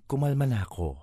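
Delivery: ordinary delivery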